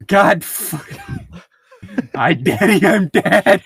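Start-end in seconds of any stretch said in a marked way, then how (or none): none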